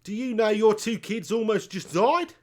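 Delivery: high, hammed-up Aussie accent; mock-scolding in a put-on Australian twang; exaggerated Australian question, swooping up at the end. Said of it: "It's not my best accent".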